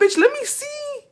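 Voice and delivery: very high squeaky falsetto; squealed mock panic. Friend demanding to see the Facebook page, squealed mock panic.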